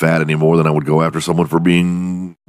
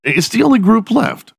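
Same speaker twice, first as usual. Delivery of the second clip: high-pitched musing. Retold or imitated. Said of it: "'Cause I thought".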